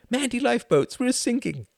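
put-on a small girl proud of her joke; squeaky girl punchline. A narrator's reading. Little girl delivering the punchline to her uncle, high and gleeful.

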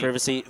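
"Privacy" with a short i; short-vowel British "Privacy". Retold or imitated. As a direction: say "Privacy" with PRIV as in privet. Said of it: "You say privacy?".

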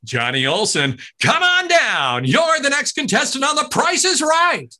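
high, loud, slow come on down; high booming slow game show call; announcer call. Retold or imitated. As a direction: belt it like the famous announcer. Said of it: "He hands me the microphone".

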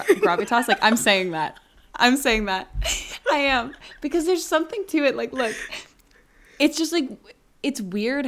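with gravitas. Shown as a demonstration. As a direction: say it like it truly matters. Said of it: "give it some gravitas".

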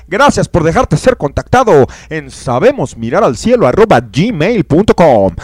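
loud, slow radio plug; announcer. Acted. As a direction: read it out like a show announcement. Reads show contact plug.

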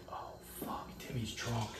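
hushed unvoiced aside; whisper. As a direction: whisper it so Timmy won't hear. Spotting drunk Timmy, alarmed.

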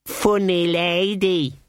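put-on slow, high little child; a small boy amused; toddler voice. A narrator's reading. Pusey grinning at Granny.

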